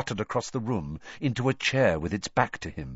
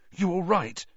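worried friend; 10 semitones higher than usual. A narrator's reading. Ron worried over Harry.

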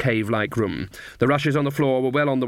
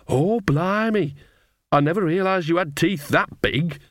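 slow, higher startled exclamation; startled old woman; 6 semitones higher than usual. Acted. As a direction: gasp at the big teeth. Nanny sees the wolf.

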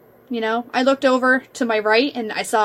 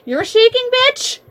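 sassy; 13 semitones higher, 4 dB louder than usual. Retold or imitated. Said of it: "And I'm like".

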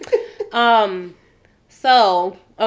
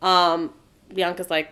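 normal talking voice

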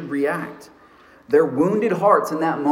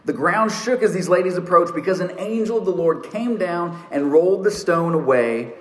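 normal talking voice

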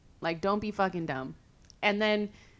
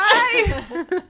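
squealing laugh; high giggle; 11 semitones higher, 8 dB louder than usual. Real, not acted. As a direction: burst out giggling.

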